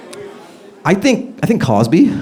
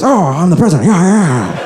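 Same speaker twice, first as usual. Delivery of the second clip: mock relieved, delighted president, higher pitch; happy dawning realization, hammed up; Biden impression; 6 semitones higher than usual. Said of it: "forgetting and remembering your president".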